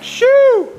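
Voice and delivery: high, loud excited shoo; excited whistle-shout. Real, not acted. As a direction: blurt it out in sheer delight. Admiring new radiator's welds.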